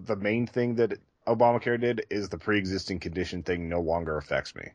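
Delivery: ordinary talk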